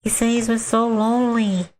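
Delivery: put-on creepy little girl. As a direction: innocent kid telling something eerie. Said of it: "the little girl's like".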